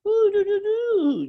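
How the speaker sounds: put-on very high, loud cooing baby talk; greeting mom's new dog in baby talk. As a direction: coo at the puppy like a baby. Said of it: "speak in this baby voice".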